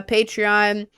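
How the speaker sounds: usual speaking voice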